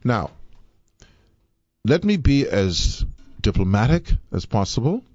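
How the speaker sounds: normal talking voice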